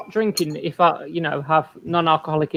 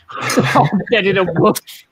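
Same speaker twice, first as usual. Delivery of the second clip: laughing; 5 dB louder, slower than usual. Real, not acted. Laughing echo of friend.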